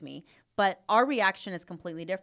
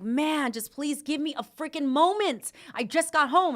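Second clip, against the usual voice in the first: higher, exasperated plea for a moment; 9 semitones higher, 3 dB louder than usual. Retold or imitated. Mother retelling how she snapped at her daughter, exasperated. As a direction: beg for a break after a long day. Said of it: "And I'm just like".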